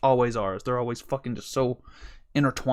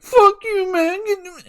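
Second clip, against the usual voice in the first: high, loud mock-tearful yell; imitated crying anger; 7 dB louder, much slower than usual. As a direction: yell it as if furious and in tears. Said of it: "he's crying. He's like".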